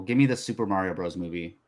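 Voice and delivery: ordinary talk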